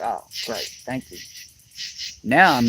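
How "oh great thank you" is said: sarcastic. Retold or imitated. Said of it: "I'm like".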